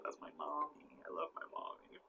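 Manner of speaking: very soft, almost whispered affection; tender doting aside. Real, not acted. Vlogger showing off mom's picture, tender and hushed. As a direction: drop to a small, loving murmur.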